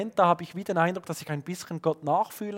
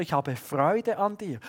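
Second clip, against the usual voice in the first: ordinary delivery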